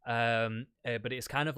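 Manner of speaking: usual speaking voice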